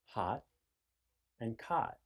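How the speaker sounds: "hot" and "cot" said with the same open "ah"; one vowel for "hot" and "cot"; merged vowels. Shown as a demonstration. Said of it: "you might find that you say".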